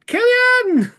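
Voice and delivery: high, loud yell down a hole; long high calling shout. Acted. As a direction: bellow the name into the void. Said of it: "I stick my head in".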